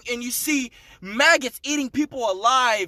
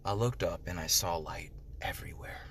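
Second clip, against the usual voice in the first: awed whisper; 13 dB quieter than usual. Retold or imitated. Awed memory of seeing heaven.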